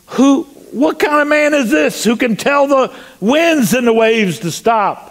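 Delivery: high, incredulous outburst; astonished disciples. Acted. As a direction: cry out in acted amazement. Acts out astonished disciples after the storm.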